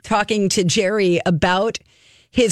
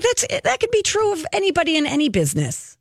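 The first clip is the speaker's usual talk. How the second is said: quick, higher dismissive Jerry impression; imitating Jerry, brisk; 9 semitones higher, faster than usual. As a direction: toss it off fast and high like Jerry. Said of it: "He's like".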